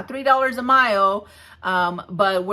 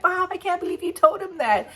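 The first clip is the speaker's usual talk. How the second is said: higher-pitched imitation of an amazed son; laughing teenage son; 10 semitones higher than usual. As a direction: mimic your kid's disbelief in a high voice. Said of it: "was just laughing".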